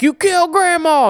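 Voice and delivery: high-pitched freak-out; Chop Top. Retold or imitated. Retelling the villain seeing Grandma hurt, freaking out. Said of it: "Chop Top freaks out".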